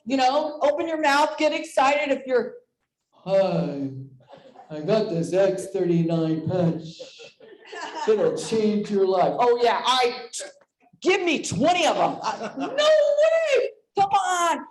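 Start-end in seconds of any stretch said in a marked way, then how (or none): 3.2-9.3 low, slow salesy pitch, lightly voiced; mock salesy voice. Imitating a salesperson pitching a patch, low and slow. 12.2-14.7 high, slow disbelieving squeal with a laugh; mock disbelief. Imitating a stunned listener, high and slow.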